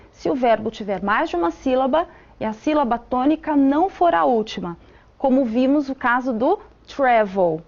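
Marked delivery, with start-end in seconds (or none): none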